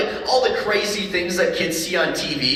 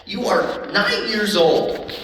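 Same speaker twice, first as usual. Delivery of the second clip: incredulous dad; 7 semitones higher, slower than usual. Retold or imitated. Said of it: "I'm like".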